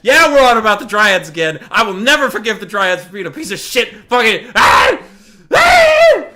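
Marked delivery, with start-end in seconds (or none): 1.7-4.5 loud angry shouting; loud real fury at the Dryads; furious rant. Real, not acted. Ranting at Dryads, shout heard. 4.6-6.2 very high, loud raging yell; real frustrated scream of fury; furious scream. Real, not acted. Wordless rage scream.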